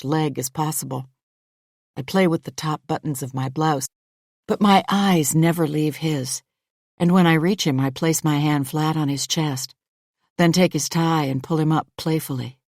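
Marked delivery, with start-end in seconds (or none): none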